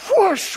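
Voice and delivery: high rushing whoosh of a plunge; imitated whoosh. Shown as a demonstration. Preacher imitates the raft plunging into the rapid, whoosh.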